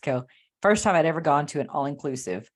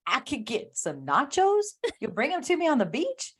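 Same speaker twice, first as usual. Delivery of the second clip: amazed disbelief; 8 semitones higher than usual. Retold or imitated. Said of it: "And I go".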